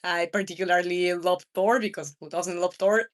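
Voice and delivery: normal talking voice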